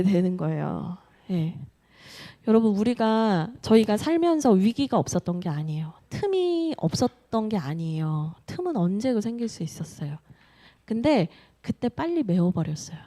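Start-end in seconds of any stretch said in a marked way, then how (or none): none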